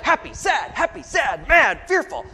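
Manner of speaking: high, loud rapid-fire list of feelings; hammed-up frantic outburst; mock emotional overload. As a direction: shout each emotion as if bombarded. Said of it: "you got ten thousand emotions coming".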